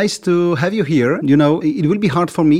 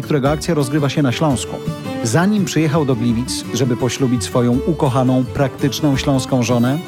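polished announcer read over music; radio intro read; faster than usual. Acted.

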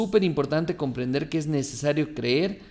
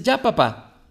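put-on higher and louder boy, proud he's done; a small child announcing he's finished; 7 semitones higher, 5 dB louder, slower than usual. Child returns to father. (voz aguda de niño orgulloso)

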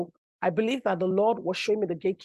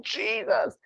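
higher cry of the name; retold high scream; 7 semitones higher than usual. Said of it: "I will scream".

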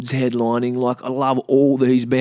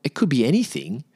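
higher-pitched disbelieving repeat; incredulous echo; 5 semitones higher than usual. Retold or imitated. Patient echoes the doctor's vague verdict, incredulous. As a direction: throw the words back in disbelief. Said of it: "And I'm like".

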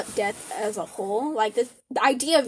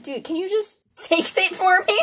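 high, pleading voice; a hint of a whiny needy friend; whiny demanding self quote; 8 semitones higher, 4 dB louder than usual. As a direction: beg someone to fix it all for you.

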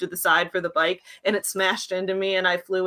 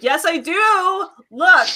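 dog voice; 8 semitones higher, 5 dB louder, slower than usual. Retold or imitated. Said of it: "he'll be like".

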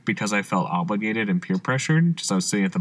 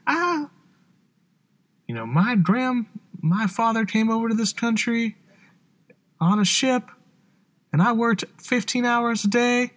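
put-on high, slow grumbling old-timer; old person voice; 11 semitones higher than usual. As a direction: complain like a grandpa from the fifties. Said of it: "Is this, like, old people?".

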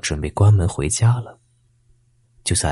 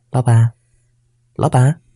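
coaxing call, a bit louder; soft calling voice; 5 semitones higher, 5 dB louder than usual. A narrator's reading. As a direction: call out sweetly to lure. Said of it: "那个柔柔的声音又响了起来".

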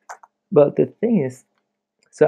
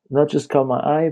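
ordinary talk

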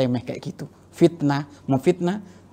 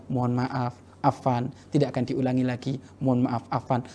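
softer, humble wife's apology; gentle apology; 3 semitones lower, 7 dB quieter than usual. Retold or imitated. Wife apologising to her angry husband, softer. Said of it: "menjawab kepada suamimu dengan jawaban".

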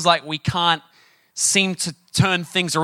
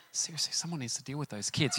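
very soft, disapproving aside; mock disgust; 15 dB quieter than usual. Parent disowning their own misbehaving kids, hushed mock disgust. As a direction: say it under your breath as a judging onlooker. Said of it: "watching with disgust".

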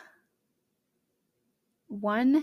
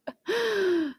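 surprised gasp; 10 semitones higher than usual. Retold or imitated. Dad has the cord.

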